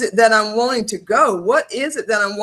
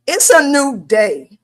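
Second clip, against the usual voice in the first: ordinary talk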